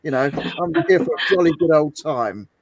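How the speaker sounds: hammed-up upper-class English accent, a jolly toff; posh English accent. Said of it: "you've got to call with a posher accent".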